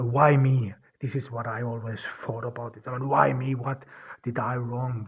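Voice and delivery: ordinary talk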